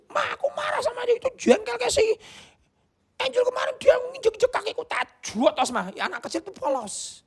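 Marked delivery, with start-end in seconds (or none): 0.1-5.9 put-on much higher childlike whine; child telling mum about Angel, in a high childish voice. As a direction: complain like a little kid to mum. Child complaining to mother.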